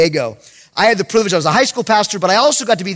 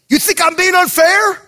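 high-pitched, indignant question; angry challenge; 10 semitones higher, 3 dB louder than usual. Retold or imitated. Head coach turning on the youth pastor, angry challenge. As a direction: challenge him as the imitated coach.

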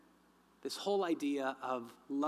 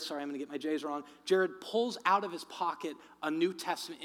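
usual speaking voice

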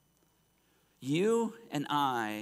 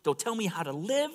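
acted defiance, pitched up; defiant; 8 semitones higher than usual. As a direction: push back like a rebel refusing orders. Acting out a defiant, rebellious heart.

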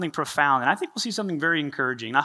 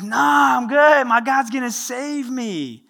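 high, loud, then slow; stubborn refusal; 8 semitones higher, 3 dB louder, much slower than usual. Acted. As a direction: shout him off, dead sure of himself. Stubborn man refusing rescue.

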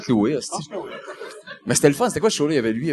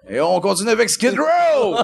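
DJ voice; 9 semitones higher than usual. Pretend. Mock DJ announcing next song.

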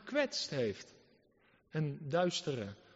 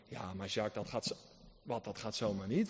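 much lower, softer, a doubting churchgoer; objecting believer; 10 semitones lower, 8 dB quieter than usual. Acted. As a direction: mumble the excuse low and quiet. (zachte, lage twijfelende gelovige)

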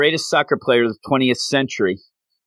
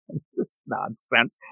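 ordinary talk

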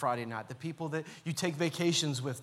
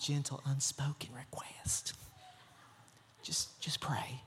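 whispered, much softer and lower, mocking; 3 semitones lower, 9 dB quieter, slower than usual. Churchgoer asking prayer vaguely, mock soft whisper. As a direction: hush it like a pious secret. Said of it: "It's just a real soft".

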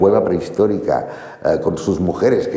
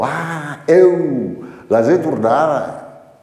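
high, slow Venetian shopkeeper voice; drawn-out Venetian greeting, pitched up; Venetian dialect quote; 8 semitones higher, 3 dB louder, much slower than usual. Retold or imitated. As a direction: imitate the Chinese ice-cream seller speaking Venetian. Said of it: "me dijo".

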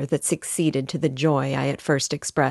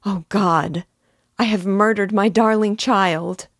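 rising to an anguished cry, louder and higher; anguished exclamation; 5 semitones higher, 5 dB louder than usual. A narrator's reading. Mother crying out over her dead child, anguished cry. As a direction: cry it out as the horror sinks in.

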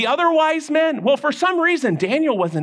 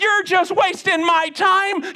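high, slow, angry king's voice; 11 semitones higher, slower than usual. Acted. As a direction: snap it out, fed up. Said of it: "Then he got really mad".

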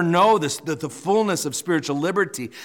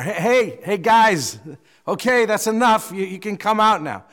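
mock yell, louder and higher; 6 semitones higher, 5 dB louder than usual. King calling to the men inside the furnace, mock yelling. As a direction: call out to people some distance away. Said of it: "And he yells in there".

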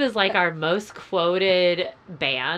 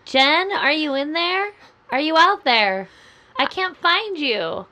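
raised voice calling out into the dark; calling from far away; 5 semitones higher, 6 dB louder than usual. Pretend. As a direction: call her name like she's lost somewhere.

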